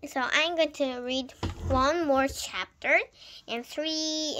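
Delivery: ordinary delivery, nothing marked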